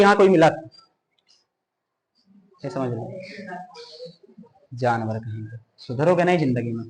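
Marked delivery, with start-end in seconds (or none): 2.6-6.8 muttered scolding. Real, not acted. Teacher scolds inattentive students.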